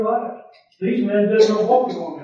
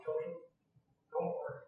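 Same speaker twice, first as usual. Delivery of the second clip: soft angel voice; 18 dB quieter, faster than usual. Acted. Angel speaks to Joseph.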